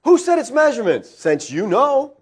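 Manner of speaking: pointed, high-pitched sarcastic question; mock deference to a know-it-all. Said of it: "The Lord is being sarcastic here".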